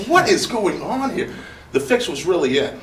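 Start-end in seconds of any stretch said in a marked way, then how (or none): 0.1-1.3 incredulous. Retold or imitated. Retold disbelief.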